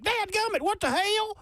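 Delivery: high, hammed-up twangy curse; mock cussing in a twang; mock Southern cussing. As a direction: yelp it like a prim country gal. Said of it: "where she's like".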